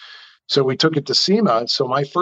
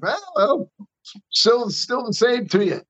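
higher, mocking brush-off; retold smug brush-off; 11 semitones higher than usual. Telling the Chrysler caller he still doesn't care, mock smug. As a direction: toss it back with a smug, high sneer.

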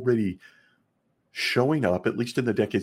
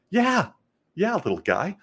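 mock adult reply; 10 semitones higher than usual. Acted adult answering the scared kid.

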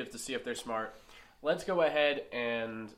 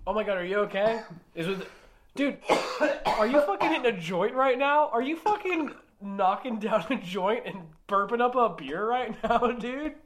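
high and loud, amazed; excited shocked yelling; 8 semitones higher, 8 dB louder than usual. Acted. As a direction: yell in disbelief, then hype him up. Character shocked, cheering friend on.

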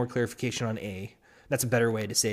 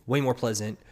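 normal talking voice

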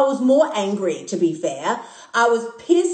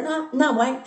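ordinary talk, nothing marked

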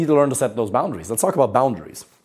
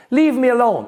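higher and louder mock yell; upset outburst; 12 semitones higher, 4 dB louder than usual. Girlfriend storming out on partner, higher louder mock outburst. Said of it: "and she storms out of the room".

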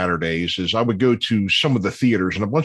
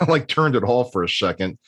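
ordinary talk

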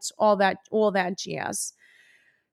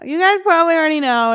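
higher, louder, fast chirpy "You guys probably already know"; bright redo; 5 semitones higher, 6 dB louder, faster than usual. Acted. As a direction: bounce it out cheerfully. Redo without swear, bright.

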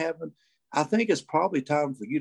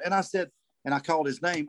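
normal talking voice

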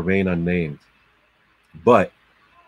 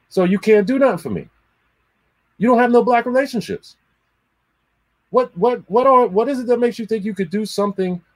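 much higher, fast indignant scolding; retold heated rant; 13 semitones higher, 3 dB louder, faster than usual. Telling off the brand rep, high and fast. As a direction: give it to him with scorn.

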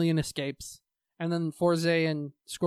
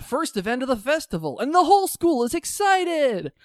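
high, loud mock hype; hammed-up thrilled announcement; excited; 8 semitones higher, 4 dB louder than usual. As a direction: build up big festival excitement. Hyping the festival's excited crowd.

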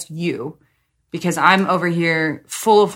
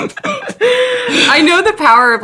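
high, slow words through laughter; laughing; 10 semitones higher, 5 dB louder, slower than usual. Real, not acted. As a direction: get the words out while still laughing. Laughing at sex joke.

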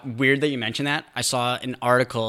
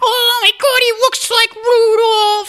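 cooing neighbor; 9 dB louder, slower than usual. Retold or imitated. Mocking neighbors cooing at dog.

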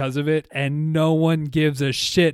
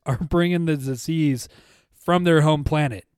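usual speaking voice

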